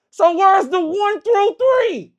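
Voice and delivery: high, slow, loud indignant question; slow it down and let the outrage climb; outraged disbelief. Retold or imitated. Spotting the rigged dice, outraged.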